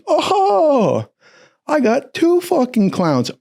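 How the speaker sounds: high, drawn-out gloating; mock-delighted discovery. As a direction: imitate an instructor who has caught his culprits. Chief catches two culprits.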